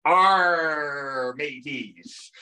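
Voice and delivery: long drawn-out pirate "Arr"; pirate growl. Acted. Lecturer greeting the crowd as a movie pirate, pirate voice. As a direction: call out a hearty, stretched pirate greeting.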